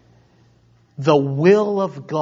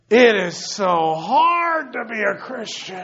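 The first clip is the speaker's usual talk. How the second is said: hammed-up slow moan ending in a sigh; 5 semitones higher than usual. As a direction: groan it out like a suffering believer. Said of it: "Now you're miserable".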